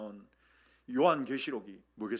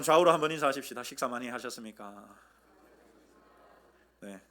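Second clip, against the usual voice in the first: ordinary talk, nothing marked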